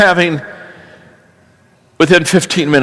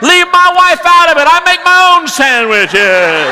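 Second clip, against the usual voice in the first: high punchline; 11 semitones higher than usual. Acted. Worker admitting he makes his own sandwiches, high and slow. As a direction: deliver the punchline indignantly.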